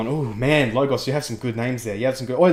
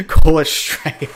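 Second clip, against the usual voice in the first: laughing; 8 semitones higher, 6 dB louder, slower than usual. Real, not acted. Laughing at a suggested name.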